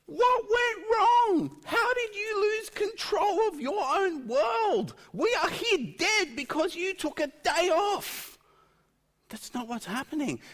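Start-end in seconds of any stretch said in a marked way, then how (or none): 0.1-8.4 very high, loud wail of protest; outraged accusation. Acted. Preacher acting confused martyrs blaming God, outraged. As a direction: cry out in mock outrage, drawn out. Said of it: "they are not in a state where they go".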